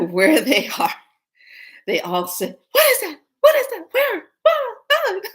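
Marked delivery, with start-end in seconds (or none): 2.7-5.2 loud, very high yelping; mock panic. Shoppers all pranking at once, mock panic. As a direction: yelp it like a pretend stampede.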